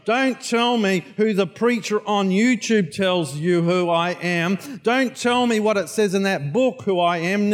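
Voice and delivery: preaching at full force, rising to a shout; forceful preaching. Acted. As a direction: hammer the question home. Preacher pressing the congregation.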